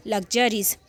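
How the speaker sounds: ordinary talk, nothing marked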